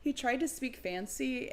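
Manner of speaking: ordinary delivery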